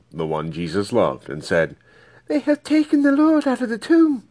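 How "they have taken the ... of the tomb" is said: much higher voice for Mary, distressed; Mary's voice. Acted. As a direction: voice Mary high, anxious and urgent. Mary reports the empty tomb.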